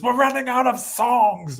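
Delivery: mock singer plea. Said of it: "he says".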